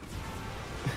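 soft breathy chuckle under the music; a quiet laugh to himself. Real, not acted. Winning easily against a weak opponent, soft chuckle. Easy win unfolding.

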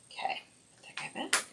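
soft whispered aside to herself. Real, not acted. Makeup artist mutters to herself while swapping brushes, whispering. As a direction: breathe it out as a private aside.

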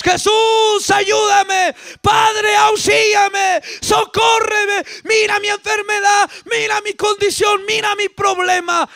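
high, shouted plea to God; crying out. Acted. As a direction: cry for help in urgent bursts. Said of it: "estás ahí gritándole, estás clamándole a Dios".

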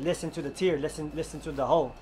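usual speaking voice

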